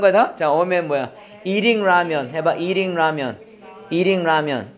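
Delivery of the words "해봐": usual speaking voice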